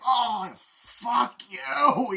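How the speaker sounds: loud, high, drawn-out yell; pained outraged shout. Retold or imitated. Man shot in the leg curses his attacker, agonised shout. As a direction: howl the curse like you've been shot.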